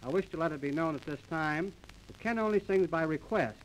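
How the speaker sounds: ordinary talk, nothing marked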